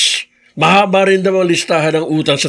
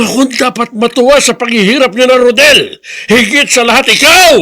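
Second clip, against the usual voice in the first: rising to a loud, bitter shout; angry shout; 4 semitones higher, 3 dB louder than usual. Acted. Grandfather rousing Edmond against Rodel's family, angry shout. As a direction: hammer home that he was wronged.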